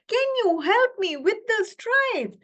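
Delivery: high pleading zebra voice. Acted. Zebra begs the king of the jungle, high and pleading. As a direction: beg the king for help, high. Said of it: "she says".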